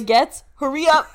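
song character's call. Acted. Co-host quoting the Marie line from the song, called out. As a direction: call it out like a musical townsperson. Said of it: "That's the Marie from the song".

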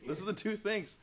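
high, loud, cracking up; exasperated doctor breaking into laughter; retold doctor laughing in disbelief. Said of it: "And he goes".

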